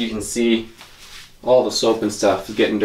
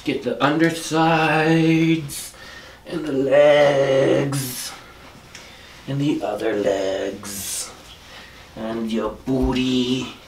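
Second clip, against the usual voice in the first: slow drawn-out; much slower than usual. Real, not acted. Slowly drying the dog.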